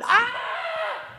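sudden high strangled cry; inner agitated cry. Retold or imitated. Inner cry of agitation, a high strangled yelp. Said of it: "oftentimes I'm going".

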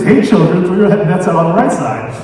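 loud mock hail of a random dude; mock shout. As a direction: holler it from a hundred yards. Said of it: "but he says".

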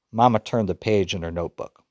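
ordinary delivery

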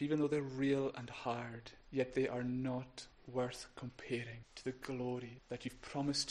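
low, softened praying voice; prayer. Real, not acted. Minister praying to the Father for the congregation, quiet prayer.

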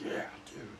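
breathy whispered rasp; a hint of a sick voice. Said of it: "It's like".

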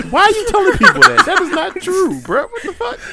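high, loud objection through laughter; laughing protest. Real, not acted. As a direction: protest incredulously while cracking up. Embarrassed protest amid friends' teasing.

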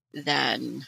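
ordinary talk, nothing marked

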